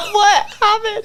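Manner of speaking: high, loud laughing question; real amused laughter. Real, not acted. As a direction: laugh through the words in disbelief. Laughing at her own story.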